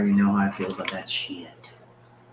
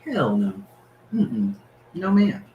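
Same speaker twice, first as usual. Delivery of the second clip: higher, sassy no ma'am; sassy refusal; 6 semitones higher than usual. Acted. Host rejecting a seventy mile an hour blizzard, sassy. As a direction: turn it down with a pitched-up sass.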